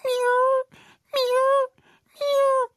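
very high pitiful meows; tiny high crying meow; crying kitten. Acted. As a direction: mew like a scared kitten up a tree. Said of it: "started crying".

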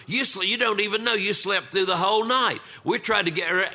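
usual speaking voice

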